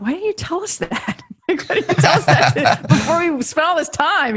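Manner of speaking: high, laughing mock outrage; exasperated laugh at the agent's news; laughing disbelief. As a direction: laugh through the complaint in disbelief. Retelling her frustrated reply to agent.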